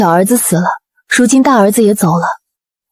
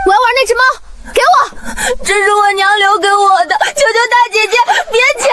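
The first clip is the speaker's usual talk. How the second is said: put-on high, whining little girl; very high-pitched childish wail; child's voice; 12 semitones higher, faster than usual. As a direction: plead like a small child. Childhood flashback, child pleading.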